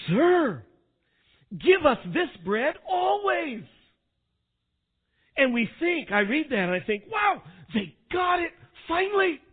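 high, loud, delighted outburst; eager crowd. Acted. Preacher voicing the crowd who seem to get it, elated. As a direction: voice an excited crowd finally getting it.

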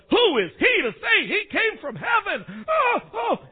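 high, loud outraged protest; hammed-up scandalized crowd, voice jumping up; indignant grumbling. Preacher acting the crowd scoffing at Jesus' claim, indignant grumbling. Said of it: "they grumbled about him".

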